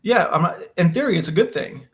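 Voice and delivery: ordinary delivery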